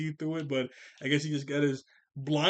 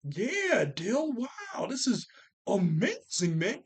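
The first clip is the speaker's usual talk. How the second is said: much higher, hollow fake amazement; phony wow; 8 semitones higher, much slower than usual. [name] faking belief to his brother, phony amazed. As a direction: oversell it so it rings false. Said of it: "he's just sounding so phony".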